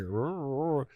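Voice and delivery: soft strained growling grunts, imitated; mock frozen groan. Imitating a stunned Van Arsdale with soft strained grunts. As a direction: grunt twice like a stunned fighter who can't move. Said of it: "Van Arsdale is like".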